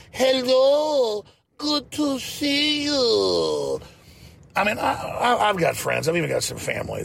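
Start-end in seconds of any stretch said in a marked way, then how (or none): 0.1-3.8 high, very drawn-out mocking greeting; slow mock talking-down voice; Rick Perry. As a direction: stretch each word out, high and condescending. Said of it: "I made fun of Rick Perry".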